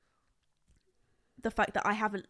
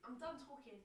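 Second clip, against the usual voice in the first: quiet; 21 dB quieter than usual. Real, not acted.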